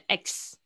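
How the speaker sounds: "X" with an open eh, as in "ecks"; imitated mispronunciation. Shown as a demonstration. Teacher showing the student's mistake, "X" said "ecks". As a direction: copy the student's heavy "ecks" for "X". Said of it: "你会念成X".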